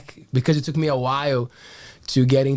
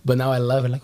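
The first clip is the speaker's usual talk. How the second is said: ordinary talk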